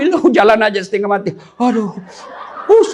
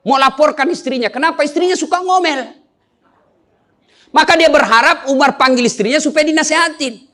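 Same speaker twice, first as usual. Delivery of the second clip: normal talking voice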